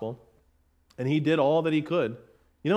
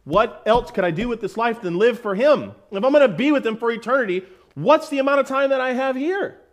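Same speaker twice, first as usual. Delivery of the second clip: impassioned quote; 8 semitones higher, 6 dB louder than usual. Retold or imitated. Preacher quoting an impassioned thought.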